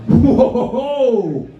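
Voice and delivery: mock gasping at a car part; mock amazement. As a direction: overplay being impressed by the part. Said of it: "I'm like".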